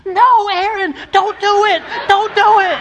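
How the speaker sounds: put-on high, loud child's voice urging his brother; little brother warning. Dad voices his son warning his brother in a high kid voice. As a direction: squeak out a warning like a young boy.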